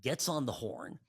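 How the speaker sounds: ordinary talk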